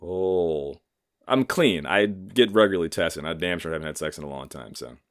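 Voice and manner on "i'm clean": normal talking voice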